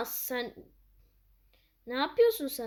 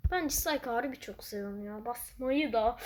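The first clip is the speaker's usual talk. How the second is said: usual speaking voice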